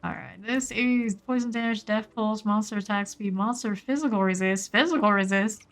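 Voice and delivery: normal talking voice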